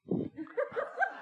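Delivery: high breathless giggle at his own bit. Real, not acted. Pastor cracking up after his fangirl bit, high giggle. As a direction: let a squeaky laugh slip out.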